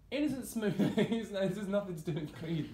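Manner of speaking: high, laughing, slowed words. Real, not acted. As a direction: laugh through the brand name. Laughing complaint.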